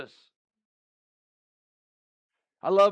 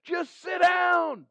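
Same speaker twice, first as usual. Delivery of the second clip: slow, high shouted order; screaming command; 8 semitones higher, slower than usual. Retold or imitated. God shouting at his restless people to sit down. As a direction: bellow it like heaven losing patience. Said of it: "God's screaming at us".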